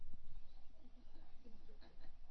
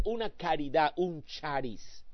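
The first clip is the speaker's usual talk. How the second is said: beggar pleading; 7 dB quieter, slower than usual. Retold or imitated. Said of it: "tiene la mano estirada y dice".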